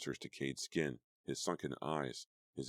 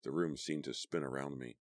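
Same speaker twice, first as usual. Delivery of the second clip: ordinary delivery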